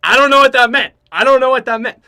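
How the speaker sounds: loud, high, baffled outburst; exasperated shout. Real, not acted. Confused by the Russian's words, a loud baffled shout. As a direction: throw up your hands and yell it. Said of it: "not being Russian myself".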